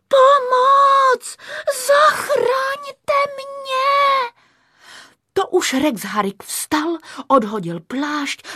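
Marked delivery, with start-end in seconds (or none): 0.1-4.3 very high, drawn-out scream for help; overacted damsel in distress, loud; heartrending cry. A narrator's reading. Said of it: "srdcerivně zvolala". (srdcervoucí volání o pomoc)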